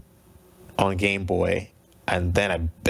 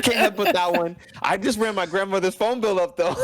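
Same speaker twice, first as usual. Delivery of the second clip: laughing through the words, pitched higher; 12 semitones higher, 4 dB louder than usual. Real, not acted. Guest joking to the host about his N64, laughing.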